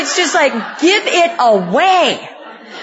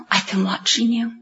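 whisper; 6 semitones lower, 7 dB quieter than usual. Retold or imitated. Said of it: "She said".

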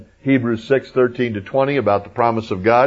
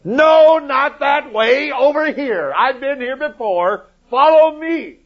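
very high, strained holler; mock yelling; slower than usual. Moses in the desert yelling directions, high hammed-up holler. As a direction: bellow the first word, then keep calling high and drawn out. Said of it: "I can see Moses in the desert yelling at him".

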